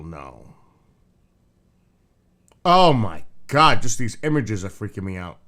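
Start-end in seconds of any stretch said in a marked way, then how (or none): 2.7-3.8 freaked out gasp. Real, not acted. Freaked out by spider images.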